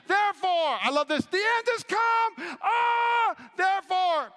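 high, loud preacher's cry building to a shout; preaching shout. Real, not acted. Preacher crying out scripture to the church, loud and high. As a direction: shout the text out like a revival preacher. Preacher reaching climax of text.

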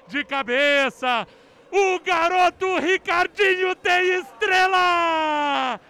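slow, shouted goal call; explosive goal commentary. Real, not acted. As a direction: roar the goal out.

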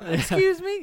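mock indignant girl. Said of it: "she's like".

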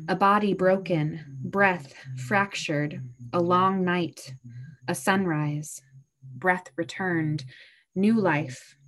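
measured, gentle poetry reading; a pastor reading an Easter poem tenderly. Acted. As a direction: read it like a reflective poem, unhurried.

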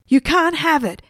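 high-pitched, contained scream. A narrator's reading. Stephen keeping the burger from the spirit, screaming. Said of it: "screamed Stephen".

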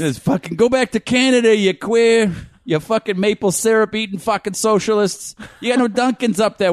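mock Boston heckler. Hockey fan berating Canadians, comic Boston tirade. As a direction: heckle them in broad Boston.

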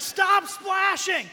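high, loud, slow panicked yell; frantic shout. Retold or imitated. Retelling yelling at his family while swimming, slow frantic shouts. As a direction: bellow it at the kids in the surf.

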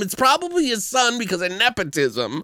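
high-pitched, incredulous rant; worked-up comic protest; exasperated rebuttal. Retold or imitated.

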